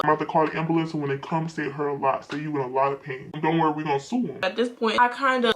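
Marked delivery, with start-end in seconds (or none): 0.1-4.4 lower, softer coaching; imitated scheming dad. Dad coaching his daughter before the ambulance, low. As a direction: give low, confidential instructions like a father. Acts out dad's low instructions.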